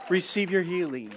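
level, gentle echo of the call. Real, not acted. Repeated after loud take.

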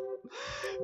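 squealing frightened laugh; a shrill laugh of fright; nervous high giggle; high scared laugh. Real, not acted. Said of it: "I'm in tears".

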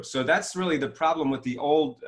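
ordinary talk

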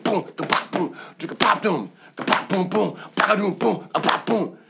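beatboxed drum pattern, boom and ka-pop; vocal drum beat. Shown as a demonstration. Producer mouthing the drum groove he imagined. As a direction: tap out the beat with your mouth. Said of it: "make it beat".

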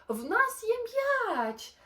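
high, loud and slow; excited shout. Acted. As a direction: stretch it out to tempt him. Dingo shouts to Kevin.

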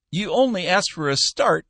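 put-on high, piping old man's voice; piped reply. Old boss piping back at his clerk, high and reedy. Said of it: "Cappy piped back at him".

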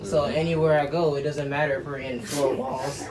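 soft, sincere and heartfelt; tender proposal speech. Real, not acted. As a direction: speak softly, from the heart.

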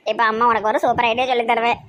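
ordinary talk

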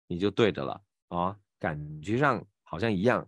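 ordinary delivery

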